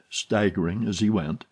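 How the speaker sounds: usual speaking voice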